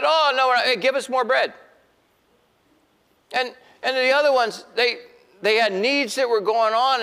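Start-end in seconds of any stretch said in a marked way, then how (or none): 0.0-1.5 high, loud protesting crowd voice; greedy crowd. Retold or imitated. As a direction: squeal it like a greedy crowd wanting more. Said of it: "They said".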